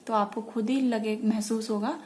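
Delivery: usual speaking voice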